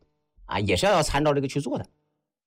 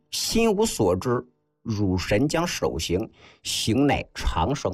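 slow, measured recital of classical text; classical recitation; slower than usual. Acted. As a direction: recite it phrase by phrase, drawn out, with pauses. (一字一顿地缓慢诵读古文)

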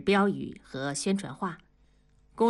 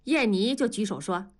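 usual speaking voice

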